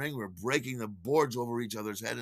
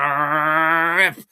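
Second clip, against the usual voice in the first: loud, drawn-out rip; long noisy imitated tear; ripping sound effect; 3 semitones higher, 11 dB louder than usual. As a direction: stretch the word like tearing cloth. Imitating the shirt tearing.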